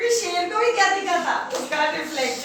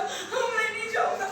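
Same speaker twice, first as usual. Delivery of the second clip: higher, whimpering little rabbit; pleading rabbit; 6 semitones higher than usual. Acted. Little rabbit refuses to go to the lion, pleading. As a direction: beg to be spared, half crying.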